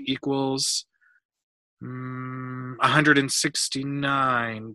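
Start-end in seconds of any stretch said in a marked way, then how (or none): 1.8-2.8 long, soft, level hum; thinking hum. A narrator's reading. Boy calculating a ladder's length, thinking hum. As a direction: hum while working out the sum. Working out a sum aloud.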